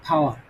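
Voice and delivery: "পাওয়ার" trailing into a vowel, r nearly silent; only hint at the last r of "পাওয়ার"; barely sounded R. Shown as a demonstration. Teacher shows a light R, "পাওয়ার" ending almost without r. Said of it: "হালকা একটু উচ্চারণ করবে".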